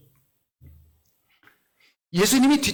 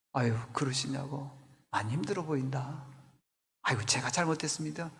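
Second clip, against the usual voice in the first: soft, much quieter, soothing apology; gentle empathy; 6 semitones lower, 10 dB quieter than usual. Shown as a demonstration. Pastor demonstrating kind words to a struggling member, gentle. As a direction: lower your voice and comfort them.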